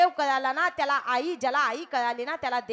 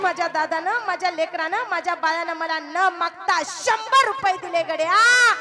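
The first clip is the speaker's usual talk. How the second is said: a hint of a proud father shouting with a laugh; lightly voiced father crowing to the village; proud old father; 6 semitones higher, 9 dB louder than usual. (गावभर ओरडून सांगणारा आनंदी म्हातारा बाप)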